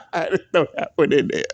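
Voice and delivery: chuckling through the words; a sly laugh after the jab; real amused chuckle. Real, not acted. Laughs at his own dig.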